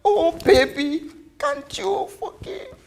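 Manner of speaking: voice cracking. Acted. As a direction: beg forgiveness with the words cracking. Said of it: "The words cracked in her lips, broken".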